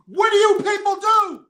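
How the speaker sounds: high, loud indignant shout; angry outburst. Real, not acted. Vlogger ranting at fans who attacked Cam, shouting. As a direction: yell it at the crowd in disbelief.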